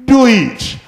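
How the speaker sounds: loud, high, ringing order; emphatic command. Retold or imitated. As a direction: drive the command home loudly. Preacher driving home Mary's command.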